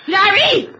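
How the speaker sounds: high, loud anguished call; anguished cry. Acted. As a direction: cry out his name. Mother finds son unconscious.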